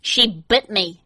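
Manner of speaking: slow, muffled into a pillow. Acted. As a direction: speak face-down into a pillow. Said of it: "he said through the pillow".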